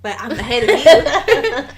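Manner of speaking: laughing it out, slow and loud; boasting to a friend about being further ahead, laughing; laughing tease. Real, not acted. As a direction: gloat through a laugh at your friend. Teasing friend about TV progress.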